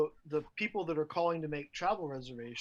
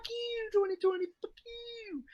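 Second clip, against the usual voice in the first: mock curse; 3 dB quieter than usual. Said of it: "cursing the year".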